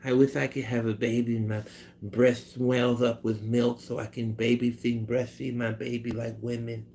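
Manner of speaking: soft, hushed impression voice; Mike Tyson. Imagining the boxer wishing for a baby, soft impersonation. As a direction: say it softly as a comic celebrity impression. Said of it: "Imagine Mike Tyson saying".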